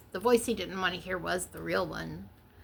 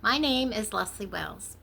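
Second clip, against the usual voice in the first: normal talking voice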